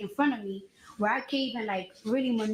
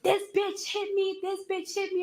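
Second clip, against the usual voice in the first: much higher, mock-frantic accusing voice; mock receptionist yelling; 8 semitones higher than usual. Mimicking the receptionist's accusation, high mock shriek. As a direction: imitate a receptionist freaking out, pitched way up. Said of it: "she started wilding out".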